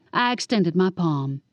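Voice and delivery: ordinary delivery, nothing marked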